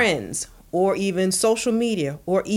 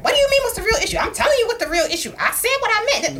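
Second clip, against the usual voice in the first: much higher, indignant mock rant; mock defensive outburst; 3 dB louder, faster than usual. Wife mocking her own defensive reply, high indignant. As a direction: play up the prickly snap-back. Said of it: "not to be like".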